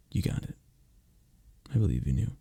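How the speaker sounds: ordinary talk, nothing marked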